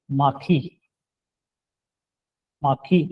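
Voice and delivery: माखी said clearly, aspirated kh; correct pronunciation. Shown as a demonstration. Teacher to class, modelling the correct sound. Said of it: "तो क्या sound होगा इसका दुरुस्त".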